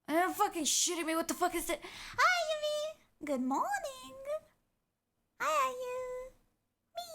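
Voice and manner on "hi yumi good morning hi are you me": sweet greeting. Real, not acted. Greeting pet/someone sweetly.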